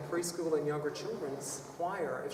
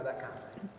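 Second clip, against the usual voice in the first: usual speaking voice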